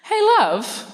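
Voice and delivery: a sweet, high-voiced mum calling her husband; storyteller plays Mum calling to Dad. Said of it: "That's my character work".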